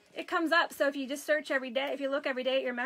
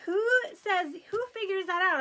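amused disbelief; 6 semitones higher, 5 dB louder than usual. Retold or imitated. Mom retelling her own disbelief.

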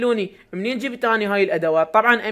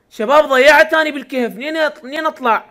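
lost and calling out; 8 semitones higher, 10 dB louder than usual. Real, not acted. Lost in the cave, calling viewers.

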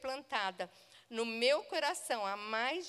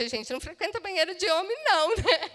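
flustered laughing excuse; 6 semitones higher, 8 dB louder, faster than usual. Retold or imitated.

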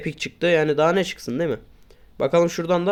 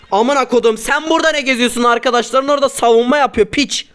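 raised, irritated scolding at a teammate; annoyed outburst; 5 semitones higher, 3 dB louder than usual. Real, not acted. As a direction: snap at the careless teammate. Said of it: "Biraz sinirlendim". (sinirli azar)

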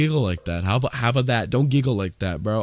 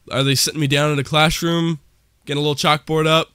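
usual speaking voice

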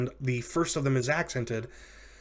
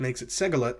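ordinary delivery

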